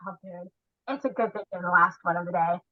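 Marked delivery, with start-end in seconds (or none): 0.1-0.5 soft, quiet. Retold or imitated. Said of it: "I was like".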